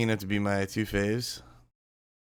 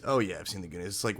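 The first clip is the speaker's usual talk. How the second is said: ordinary delivery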